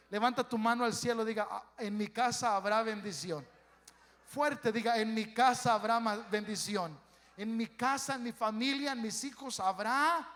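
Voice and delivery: loud, rising preacher's call to declare blessing; full-force preaching. Acted. Pastor urging the congregation to declare blessing, a loud preaching call. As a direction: make the whole church shout it back with you.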